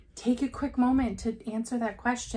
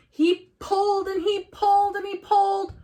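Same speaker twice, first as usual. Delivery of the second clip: higher, louder, straining pull; building excitement; 9 semitones higher, 6 dB louder than usual. A narrator's reading. Telling kids how George pulls the pan, rising strain. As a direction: heave the words like a tug.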